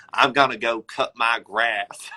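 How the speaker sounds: exaggerated imitation of an American accent; mock American accent. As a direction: mimic someone faking an American accent. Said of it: "now mimic an American accent".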